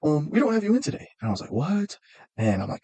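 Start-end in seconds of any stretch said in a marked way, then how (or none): 0.0-1.1 high, chirpy receptionist impression. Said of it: "And the lady goes". 1.5-2.0 high, startled "What?"; surprised what. Retold or imitated. Shocked at missing appointment.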